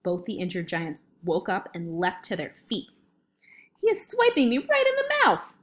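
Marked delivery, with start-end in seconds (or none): 3.8-5.5 high-pitched giant's protest; outraged yell. A narrator's reading. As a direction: yell as if just smacked awake. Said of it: "yelled the meat dripper".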